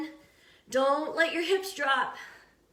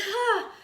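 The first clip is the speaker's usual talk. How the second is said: high, falling sigh after effort; relieved exertion sigh; 6 semitones higher than usual. Real, not acted. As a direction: let the breath go as the set ends. Reaches end of five-minute plank.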